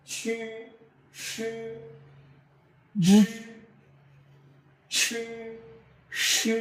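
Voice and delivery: জি and সে drawn out with a push of air; throaty pinyin sounds. Shown as a demonstration. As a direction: hold জি and সে long, pushed from the throat. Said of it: "এটার উচ্চারণ হবে".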